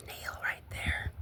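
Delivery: cautious whisper. Real, not acted. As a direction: barely breathe it out. Warns herself about a nail.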